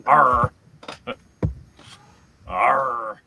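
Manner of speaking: slow pirate arr; mock pirate growl. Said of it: "You sounded like a pirate".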